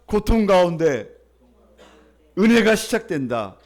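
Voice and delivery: 크게 또박또박 외치는 설교 문장; 회중이 따라하도록 천천히 선창; call to repeat. Shown as a demonstration. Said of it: "따라할까요?". (따라하라고 크게 또박또박 선창)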